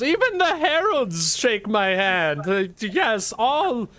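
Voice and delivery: high, slow, self-important drawl; preening high-pitched humanoid; Tech's haughty voice. Acted. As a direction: grandly offer your services. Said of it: "And she goes".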